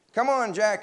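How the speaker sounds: high, loud excited call; storybook boy calling out; crying out. A narrator's reading. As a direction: call your friend to join in. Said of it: "he cried".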